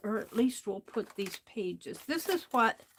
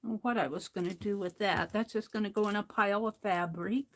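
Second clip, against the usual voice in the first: usual speaking voice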